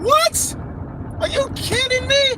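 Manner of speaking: high-pitched incredulous squeal; stunned fan losing it; shocked disbelief. Real, not acted. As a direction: shriek it in disbelief. Reacting to Oba's promo.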